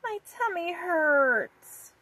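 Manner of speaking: put-on high, slow, sad little girl voice; little sister whimpering about her tummy ache. A narrator's reading. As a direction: drag it out like a hurting child. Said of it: "Vicky replied sadly".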